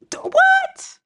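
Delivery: squeaky high what; mock disbelief. Real, not acted. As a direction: yelp it in disbelief.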